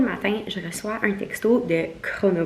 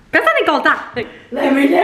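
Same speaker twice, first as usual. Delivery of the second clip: exasperated exclamation; 6 semitones higher, 4 dB louder than usual. Real, not acted. Venting about accent criticism.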